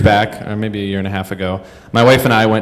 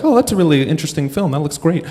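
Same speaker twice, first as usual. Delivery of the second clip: higher-pitched polite movie chat; mock-civil little review, pitched up; imitating intelligent commenter; 6 semitones higher, faster than usual. Moviegoer chatting sensibly to a friend, mock-polite. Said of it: "talking intelligently".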